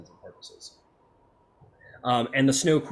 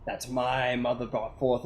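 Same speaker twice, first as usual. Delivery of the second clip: ordinary talk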